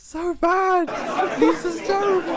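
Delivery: high, loud exclaim; high excited exclaim. Real, not acted. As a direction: cry out in delight at how bad it is. Laughing at terrible bar.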